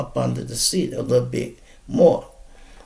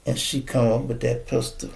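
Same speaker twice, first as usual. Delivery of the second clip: ordinary talk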